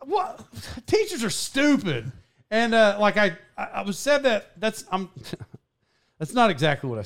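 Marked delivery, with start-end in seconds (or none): 0.0-2.1 hammed-up sass. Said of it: "ham it up for the podcast".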